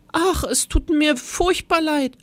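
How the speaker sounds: high, apologetic little-man voice; small voice. A narrator's reading. Teacher apologises to class while turning monster, small voice. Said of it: "mit seiner kleinen, leisen Stimme".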